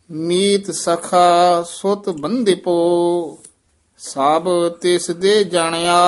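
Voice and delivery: measured chant with long held line endings; scripture recitation. Shown as a demonstration. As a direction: recite on a level tone, stretching final vowels. (Gurbani ਦਾ ਠਹਿਰਾਅ ਵਾਲਾ ਪਾਠ)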